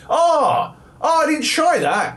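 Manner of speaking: very high-pitched mock astonishment; sarcastic realization. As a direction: feign a huge revelation, sarcastically. Mock reply to obvious online tip.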